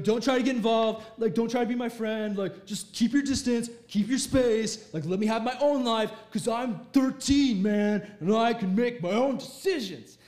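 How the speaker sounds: loud, hammed-up teenage protest; defiant teen. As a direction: tell parents off like a stroppy thirteen-year-old. Acting out a thirteen-year-old rebelling.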